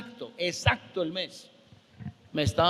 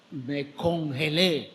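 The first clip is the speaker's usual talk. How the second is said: ordinary delivery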